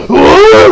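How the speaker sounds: high, loud mock battle howl; battle cry. Retelling everyone bringing stands out, mock battle cry. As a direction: let out a hammed-up attacking yell.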